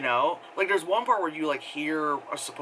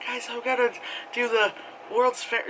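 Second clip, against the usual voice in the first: much higher, chirpy announcement; eerie vlogger voice; 9 semitones higher than usual. Retold or imitated. Girl announcing the challenge in her first video, high and bright. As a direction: pitch it up like a fake-bright vlogger.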